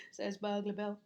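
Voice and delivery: plain reading voice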